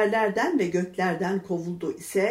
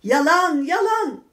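loud, high-pitched cry of denial; angry shout; 9 semitones higher, 9 dB louder, slower than usual. A narrator's reading. Ebu Cehil throws the stones down, shouting angrily. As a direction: cry it out in furious disbelief. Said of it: "diye haykırdı".

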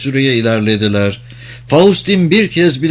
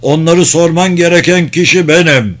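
raised, higher-pitched narrated shout; 5 semitones higher, 4 dB louder than usual. A narrator's reading. Morvan calls from the boat toward his son, shouting. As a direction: call out forcefully across a distance. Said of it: "diye bağırdı oğluna doğru".

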